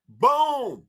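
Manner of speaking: loud high punch on boom; emphatic boom. Real, not acted. Speaker to Rashad, loud triumphant boom. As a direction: slam the word like a drum hit. Punchline after upsell quote.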